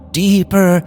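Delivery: usual speaking voice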